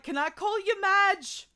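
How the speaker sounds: hammed-up Irish bus driver, poorly done; bad Irish accent. Irish bus driver nicknaming her Madge, in a bad Irish accent. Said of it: "That was a bad Irish accent".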